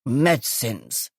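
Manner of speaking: low, slow smoky rasp; gravelly, smoky voice. Acted. Groggy guest exclaiming to police in a low smoky rasp. Croaky woman character voice.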